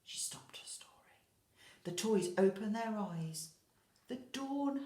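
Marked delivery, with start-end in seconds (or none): 0.0-0.9 hushed, suspenseful whisper. A narrator's reading. Dramatic pause in story.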